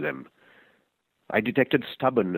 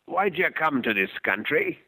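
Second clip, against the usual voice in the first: a raspy smoker's voice, a touch higher; raspy old man; 6 semitones higher than usual. Acted. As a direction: rasp the question like an old heavy smoker. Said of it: "His voice was raspy".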